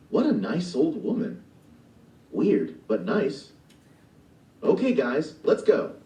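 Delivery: Gigi voice. Acted. Gigi calling his new animal friends to go, cheerful. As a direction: shrug off the weirdness and lead on. Gigi's line read in play.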